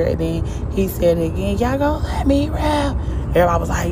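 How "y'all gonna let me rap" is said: high, hopeful plea to the crowd; pleading ask. Retold or imitated. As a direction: ask it up high, half begging. Rapper asks crowd again.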